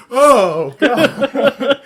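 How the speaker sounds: high, loud mock-horrified wail; hammed-up "oh God" of dread, laughter close behind; mom's phobic shriek. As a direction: imitate a mother spooked by her childhood fear. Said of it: "childhood phobia".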